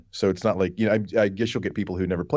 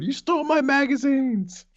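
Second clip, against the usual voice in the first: high, frantic mock scream-acting; over-the-top yelled bit, pitched way up; Billy Eichner scream acting. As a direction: shriek it like an unhinged sitcom rant. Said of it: "Billy Eichner's scream acting".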